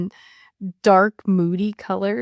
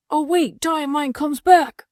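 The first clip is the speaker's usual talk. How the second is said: higher, excited race call; mock excited commentator; 7 semitones higher, 3 dB louder than usual. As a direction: call the comeback like a sports announcer. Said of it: "as if the commentator, Mandy, is saying".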